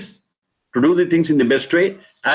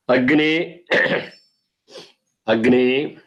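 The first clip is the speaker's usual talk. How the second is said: word-by-word recitation; much slower than usual. Shown as a demonstration.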